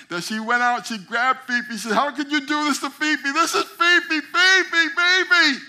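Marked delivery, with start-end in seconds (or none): 1.9-5.6 high, loud grief-stricken wail over the swan; anguished wail. Acted. Owner wailing over her shot swan, anguished. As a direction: cry out her name in despair.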